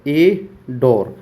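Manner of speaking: एडोर stretched out very slowly; teacher dragging out एडोर; drawn-out demo. Shown as a demonstration. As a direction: hold एडोर long for the mnemonic. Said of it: "mnemonics से कैसे याद करो?".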